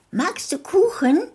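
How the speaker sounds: put-on high, eager child voice; little girl's offer. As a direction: offer the cake like a small girl. Girl Rotkäppchen voiced high.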